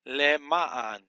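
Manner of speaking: deliberate Le Ma'an with accent after Le; stress on second syllable. Shown as a demonstration. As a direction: sound out Le Ma'an, punching the Ma. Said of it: "The accent is on the second syllable".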